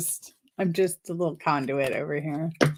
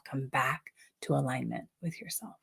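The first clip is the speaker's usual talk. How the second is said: softer, lower, fading affirmation; soft and quiet; 5 semitones lower, 10 dB quieter than usual. Shown as a demonstration. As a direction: let it fade into a hush. Repeated affirmation fading softly.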